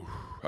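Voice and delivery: long, voiceless blown-out breath; soft whoosh of released breath; exhaling. Retold or imitated. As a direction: imitate blowing out held smoke. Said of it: "then I exhaled and went".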